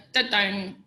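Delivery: a flat little letdown sound; the anticlimax of reaching the top; deflated da-dung. Retold or imitated. Arriving at the goal and feeling nothing, a deflated da-dung. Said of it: "got to the thing and go".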